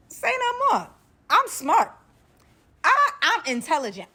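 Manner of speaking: high, loud, eager assurance; hasty, emphatic compliance. Retold or imitated. Captive reassuring a threatening captor, high and loud. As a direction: pitch it way up and punch each phrase.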